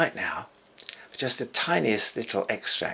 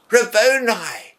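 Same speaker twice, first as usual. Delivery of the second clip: much higher, louder, slow joyful cry; 11 semitones higher, 7 dB louder, slower than usual. Retold or imitated. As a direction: cry it out in sudden recognition. Cried out in Hebrew.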